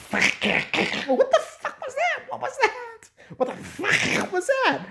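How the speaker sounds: high, slow laughing shriek; high shocked laughing cry; shrieking laughter. Real, not acted. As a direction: squeal it through laughter.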